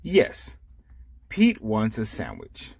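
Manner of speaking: ordinary delivery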